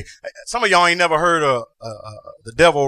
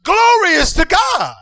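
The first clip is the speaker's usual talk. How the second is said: preacher shouting; 10 semitones higher than usual. Acted. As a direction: shout it to the rafters.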